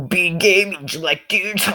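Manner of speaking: high, drawn-out explaining; mock exasperated bully. Bully explains his insult, mock exasperated. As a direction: spell it out in a high, silly voice.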